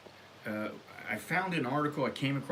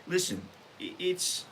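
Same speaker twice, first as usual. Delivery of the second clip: normal talking voice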